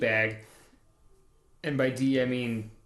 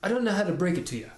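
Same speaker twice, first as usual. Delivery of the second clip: higher, fast put-on Nova Scotia accent; Irish Canadian accent; 5 semitones higher, much faster than usual. Podcaster hamming up the Nova Scotia character's accent. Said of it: "Irish mixed with Canadian".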